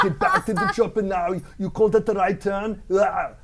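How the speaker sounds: put-on loud, high action-hero yelling in Austrian accent; hammed-up road-rage shout with a big grunt; Arnold. Comic shows host his traffic outburst, crazy action-star shouting. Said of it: "Usually when I'm being cut off in traffic".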